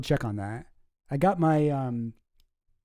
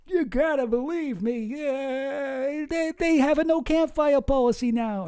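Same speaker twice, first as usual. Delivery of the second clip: high, pleading Texan drawl; Texan accent; 10 semitones higher, slower than usual. Acted. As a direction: beg her to believe you in a put-on Texas accent. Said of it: "I gave him the accent".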